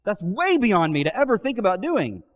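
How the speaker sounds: high, loud indignant protest; mock outraged no way, I'd never; hammed-up shouted denial of betrayal; emphatic denial. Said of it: "to be an emphatic denial".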